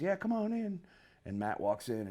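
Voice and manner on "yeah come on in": higher, easygoing call; imitating Jeff. Jeff calling visitors into his trailer, higher voice. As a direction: call out through the trailer door. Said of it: "and Jeff goes".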